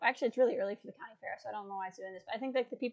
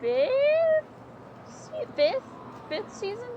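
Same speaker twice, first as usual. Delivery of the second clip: pitched way up, searching for the word; unsure, questioning; 14 semitones higher, much slower than usual. Real, not acted. Streamer trying to remember the season number, high and unsure. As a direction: ask yourself, high and uncertain.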